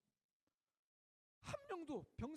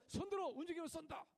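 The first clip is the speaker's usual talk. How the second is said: louder, higher barked order; sentry challenge; 6 semitones higher, 4 dB louder than usual. Retold or imitated. Sentry stopping someone on the mountain, mock barked order. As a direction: challenge like a soldier on guard. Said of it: "암호문을 대야 돼요".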